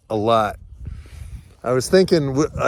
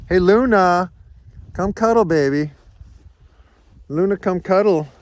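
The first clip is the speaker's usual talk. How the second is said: higher, louder call to the dog; 7 semitones higher, 5 dB louder than usual. Real, not acted. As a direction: coax the dog over from afar. Calling the dog Luna over.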